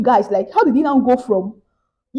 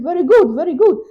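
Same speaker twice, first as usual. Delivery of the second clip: higher, eager approving voice; pleased scammer; 7 semitones higher, 3 dB louder than usual. Retold or imitated. Scammer praising her lie, higher and eager. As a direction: praise her like a greedy con man.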